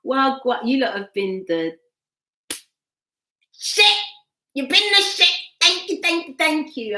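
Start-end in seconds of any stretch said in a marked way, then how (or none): 3.5-5.3 high, loud exclamation of praise; excited shout. Real, not acted. Cheering her live viewers, high excited shout. 5.6-7.0 excited thanks. Real, not acted.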